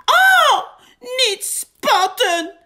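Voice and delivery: very high, loud, indignant cry; angry squeal. A narrator's reading. Big gets splashed by Mol, angry high squeal. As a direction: shriek in protest at being splashed. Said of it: "hield Big boos".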